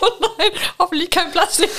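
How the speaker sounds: high laughing tease. Real, not acted. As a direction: giggle it out in fun. Laughing at choking partner.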